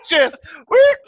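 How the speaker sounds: loud high whoop; whoop sound effect. Retold or imitated. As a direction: shout the whoop. Imitating car swerving into ditch.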